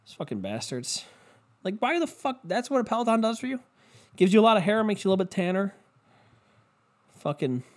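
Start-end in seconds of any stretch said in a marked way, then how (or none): 1.6-5.7 high-pitched, incredulous questioning; mock incredulity. Comic questions what a Peloton does for you, in high mock disbelief.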